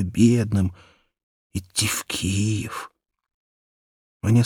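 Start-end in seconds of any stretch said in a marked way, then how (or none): 1.5-2.9 soft dreamy whisper, slowing down. A narrator's reading. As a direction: breathe it out like a secret wish. Dreamy wish to go to Kiev. (мечтательный шёпот)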